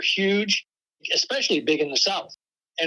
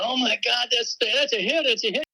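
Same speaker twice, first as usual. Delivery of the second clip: imitating excitement; 6 semitones higher than usual. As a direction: mimic thrilled record-label folks, lightly. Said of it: "just jumping up and down".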